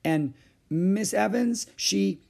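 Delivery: slow, high, reluctant start; uneasy child working up to confession; hesitant. A narrator's reading. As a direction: go on hesitatingly, leaving it hanging. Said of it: "went on hesitatingly".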